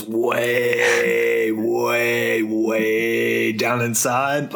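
each "way" stretched very long; slow, elongated "way, way, way"; drawn-out emphasis. Acted. Stretches way to show deep burial.

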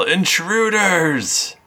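high, slow alarm shout; yelling guards. Acted. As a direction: raise the alarm at intruders. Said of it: "they yell".

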